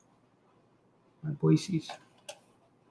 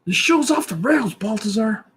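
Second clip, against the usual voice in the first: mock heckler; 6 semitones higher than usual. Mock high voice to Baltazar.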